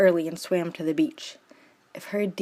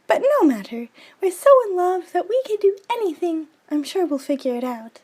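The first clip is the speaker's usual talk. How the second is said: put-on much higher, eager girlish insistence; a lovestruck girl brushing off doubt; Juliet's voice; 12 semitones higher, 4 dB louder than usual.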